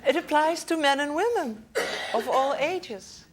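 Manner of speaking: real laughter while speaking; laughing. Real, not acted. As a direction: let the laugh carry the words. Laughing at own joke.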